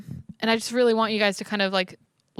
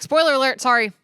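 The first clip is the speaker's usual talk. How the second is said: loud; 5 semitones higher, 5 dB louder than usual. Acted. Jokey spoiler warning.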